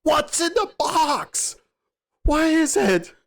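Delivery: high, slow, strained mock yell of the question; imitating a scream. Detective screaming at the killer about the box, high mock yell. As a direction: shriek it out like a panicked detective. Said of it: "Brad Pitt just starts screaming at him".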